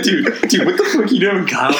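high-pitched mock panic, laughing; freaking out. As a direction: imitate a friend freaking out, amused. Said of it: "Zach started freaking out".

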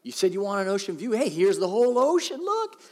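high, eager showing-off call; excited proud fish. Retold or imitated. Marlin shows Coral the view, eager and high. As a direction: proudly show off the ocean view. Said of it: "he's like".

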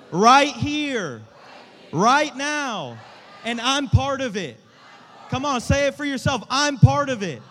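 high, full-force rallying shout; say it with your chest. Shown as a demonstration. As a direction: shout each line for the crowd to repeat with conviction. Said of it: "say this with your chest".

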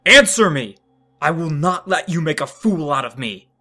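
bellowing with rage; furious shout. Acted. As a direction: yell the demand at her. Jace demanding an answer.

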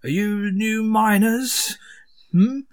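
high, slow, disgruntled sneer; grumpy hazmat worker. Acted. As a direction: grumble down at the newcomers. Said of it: "looming over you... and goes".

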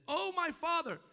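high, loud preacher's cry; bitter cry. Acted. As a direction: cry out to the father in acted anguish. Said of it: "he cried bitterly and said".

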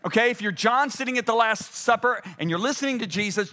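ordinary talk

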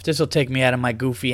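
normal talking voice

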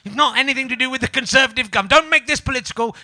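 hammed-up denial in a put-on voice; mocking Michael Gove. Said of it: "so he was on Newsnight last night going".

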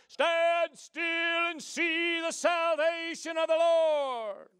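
booming, high movie-prophet shout; loud, slow biblical-epic command; Charlton Heston Moses. Retold or imitated. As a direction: proclaim it like an epic film Moses. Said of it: "Charlton Heston, right?".

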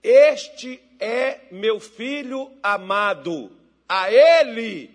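solemn, booming-loud heavenly announcement. Acted. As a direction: declare it slowly and weightily, like God from above. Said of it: "ouviram uma voz do céu".

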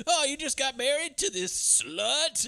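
high, slow mocking jeer; jeering bully voice. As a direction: sneer it out like a punk. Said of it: "they're going like".

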